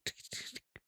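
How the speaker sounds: whispered tick tick; whispered clock noise. Retold or imitated. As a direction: tick it off softly under the breath. Staff guy mimicking a clock.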